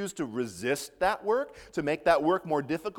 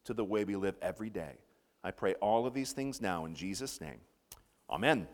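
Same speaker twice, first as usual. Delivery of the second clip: prayer; 4 semitones lower than usual. Real, not acted. Pastor praying with the congregation before the message, prayer. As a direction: lead the prayer, easing down towards the amen.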